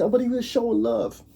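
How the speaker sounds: high-pitched imitation of objectors; mimicking defenders in a high voice; mock objection. Voicing people's objection to him.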